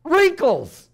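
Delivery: high, loud, eager answer; delighted little boy. Acted. Little boy looks up at grandma and answers, high and delighted. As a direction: blurt it out like an adoring child.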